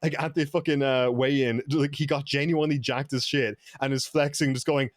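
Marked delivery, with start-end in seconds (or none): none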